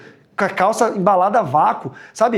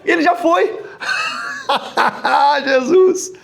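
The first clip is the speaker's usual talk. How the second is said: laughing; 7 semitones higher, slower than usual. Real, not acted. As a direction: crack up mid-sentence. Laughing at own joke. (rindo alto)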